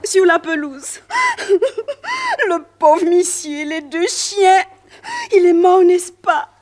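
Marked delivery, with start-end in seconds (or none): 1.1-2.4 a high, broken sob of grief; anguished sob. Acted. Maid sobbing at the sight of her dead master. 2.5-6.5 tearful. Acted. Maid grieving dead master.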